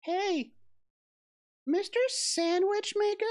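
high, sing-song calling voice; silly high voice. Pretend. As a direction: call out in a goofy high voice. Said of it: "I don't know why I'm giving this voice again".

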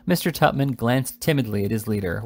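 ordinary delivery

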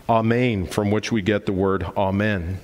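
usual speaking voice

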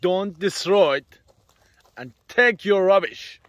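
ordinary talk, nothing marked

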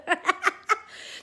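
short high giggle; giddy giggle. Real, not acted. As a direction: laugh off a guilty pleasure. Laughs at own joke.

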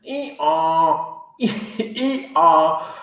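a playful donkey "ee-aw" called out twice; donkey bray. Retold or imitated. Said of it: "makes a sound like this".

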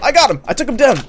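loud, high callout; excited shout. Real, not acted. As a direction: yell the callout mid-fight. Calling out during a fight.

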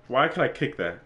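ordinary delivery

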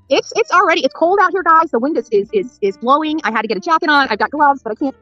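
usual speaking voice

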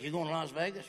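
low, soft, lightly voiced buddy; understated low-key friend voice; buddy's voice. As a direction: sidle up low and quiet as a buddy. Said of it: "buddy of mine going like this".

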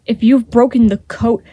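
ordinary delivery, nothing marked

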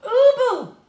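very high, loud, slow frightened toffee cry. A narrator's reading. Moonface frightened, begging Jo not to go. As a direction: wail in fright through stuck teeth. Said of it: "in a fright".

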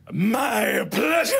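slow, drawn-out, hammed-up delight; flirty character. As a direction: relish it slowly in character. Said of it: "He says".

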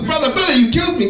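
high, loud excited greeting; calls it out big and high; excited shout. Retold or imitated. Guy at the door greets him with a loud high shout.

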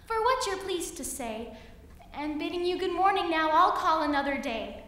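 high, loud little fly voice; buzzy housefly. Acted. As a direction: squeak it high and urgent. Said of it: "she said".

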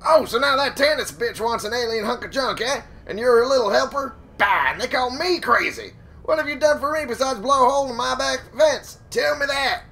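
put-on high-pitched crazy old hermit grumbling at the player. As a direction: rant like a cranky old man yelling at a stranger. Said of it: "I don't want to try and do it in his voice".

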